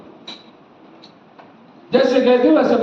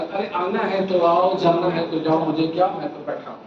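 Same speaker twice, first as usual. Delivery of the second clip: uncaring pastor; 6 semitones lower, 5 dB quieter than usual. Retold or imitated. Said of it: "जो प्यार नहीं करेगा वो क्या बोलेगा".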